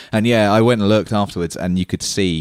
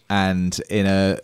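normal talking voice

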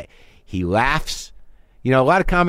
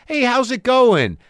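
higher, bright, slow fake cheer; fake friendly; 10 semitones higher, slower than usual. Shown as a demonstration. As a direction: put on a cheery front. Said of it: "I was talking to a made-up person".

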